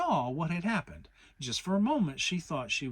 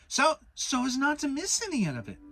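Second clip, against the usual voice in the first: much higher little voice; Piglet's voice; 12 semitones higher, 6 dB louder than usual. Acted. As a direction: chime in as tiny Piglet. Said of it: "said Piglet".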